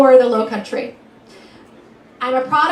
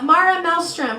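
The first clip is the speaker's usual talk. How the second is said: ordinary delivery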